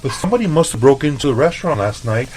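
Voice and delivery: high, mock-innocent voice, laughing through it; mimicking Lucy. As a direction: mimic a coworker feigning shock. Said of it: "and she's like".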